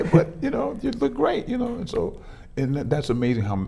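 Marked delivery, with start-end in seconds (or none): 0.0-1.8 much higher, sugary compliment; butter her up with a syrupy high voice; sweet flattery. Retold or imitated. Husband flattering wife who threatened to punch him, hammed-up sweetness.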